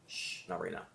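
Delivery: soft, gentle hush to a passing thought; gentle shush. Shown as a demonstration. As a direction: shush your own thought kindly and softly. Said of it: "just gently say".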